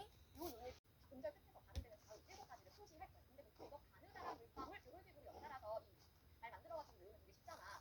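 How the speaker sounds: usual speaking voice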